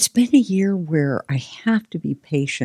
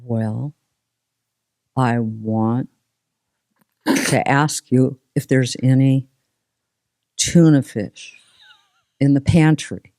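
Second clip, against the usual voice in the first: lightly imitated slow, halting ask; slow, pausing delivery with long gaps; slow talker. Guest mimicking family member's slow question. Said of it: "my family member talks like this".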